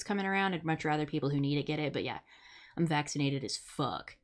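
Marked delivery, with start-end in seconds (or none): none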